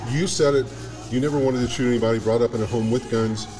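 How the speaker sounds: ordinary delivery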